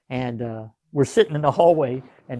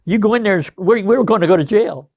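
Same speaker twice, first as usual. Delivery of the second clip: higher, louder, rushed alarm; panicked warning; 7 semitones higher, 7 dB louder than usual. Retold or imitated. Buddies rush out to warn their older comrade, panicked. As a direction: sell the fear of going to jail. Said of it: "they come out and say".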